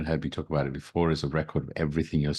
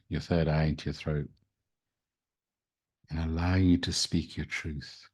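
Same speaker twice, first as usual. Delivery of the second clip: guided meditation. Acted. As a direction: guide them into calm, gently.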